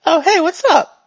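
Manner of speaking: high, loud, bouncy hello; chipper character greeting. Acted. Game master voices looping character's greeting.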